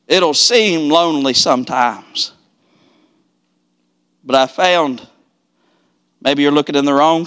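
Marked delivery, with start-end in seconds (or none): none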